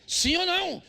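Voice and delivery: pressing the congregation for an answer; shouted repeat. Acted. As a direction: throw the question hard at the crowd. Preacher repeats question, shouting.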